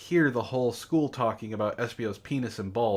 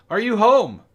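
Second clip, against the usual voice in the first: high loud call through a door; 9 semitones higher, 8 dB louder than usual. Acted. Sonic calling again at door.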